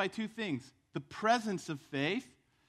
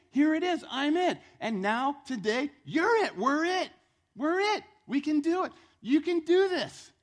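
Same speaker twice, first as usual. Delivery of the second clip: loud, raised-pitch sermon delivery; full-force preaching; 7 semitones higher, 7 dB louder than usual. Acted. Preacher tells congregation the scripture is about them, forceful preaching. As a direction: preach it with force, punching each YOU.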